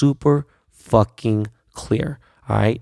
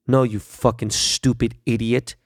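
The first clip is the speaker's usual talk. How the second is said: ordinary delivery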